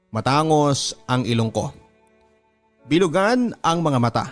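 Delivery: ordinary talk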